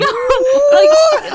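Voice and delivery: loud, high laughing protest; laughing shout of protest. Real, not acted. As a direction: yelp it out mid-laugh.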